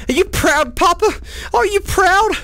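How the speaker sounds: put-on high, kid-like asking Papa; a small child seeking praise; childlike plea. Gamer asks Papa if he is proud in a high child voice. Asking imaginary dad for approval.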